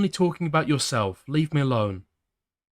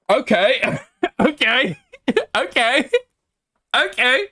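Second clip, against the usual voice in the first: laughing okay; 12 semitones higher, 5 dB louder, slower than usual. Real, not acted. Nervous laughing after shock.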